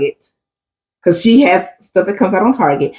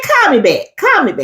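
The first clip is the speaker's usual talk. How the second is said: mock Zoe catchphrase; 8 semitones higher than usual. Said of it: "imitating Zoe's catchphrase".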